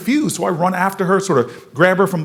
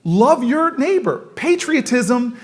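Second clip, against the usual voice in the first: impassioned speech; 6 semitones higher than usual. Acted. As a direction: build to a preacher's fervor on stage. Speech rising to preaching force.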